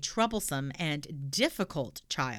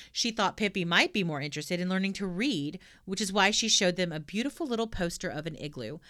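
plain reading voice

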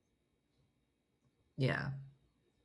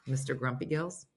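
ordinary talk

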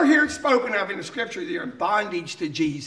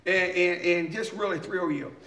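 normal talking voice

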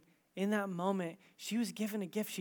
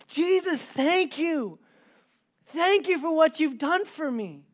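raised, heartfelt cry of gratitude; fervent thanks; 8 semitones higher, 11 dB louder, slower than usual. Retold or imitated. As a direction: call out thanks in prayer. Tearful thanks retold, preached aloud.

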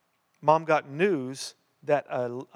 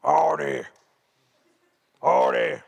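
pirate voice; 2 semitones lower, 5 dB louder, slower than usual. Retold or imitated. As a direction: growl it like an old sailor. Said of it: "he sounded like a pirate".

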